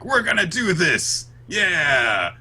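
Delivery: high, drawn-out cheer; rally the gang with hammed-up spirit; mock team rally. Host acts out everyone standing together, mock rousing cheer.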